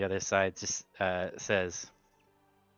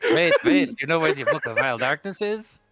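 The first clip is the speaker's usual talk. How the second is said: sudden high loud alarm; surprised; 7 semitones higher, 9 dB louder than usual. Acted. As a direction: jump in, urgently stopping them. Startled by book mention.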